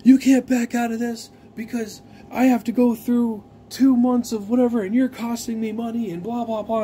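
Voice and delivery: mocking a petty seller's whine; whiny seller. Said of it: "he's like".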